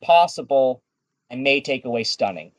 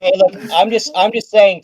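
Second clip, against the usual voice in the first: defensive; 8 semitones higher, 7 dB louder than usual. Real, not acted. DM defends house rule, laughing.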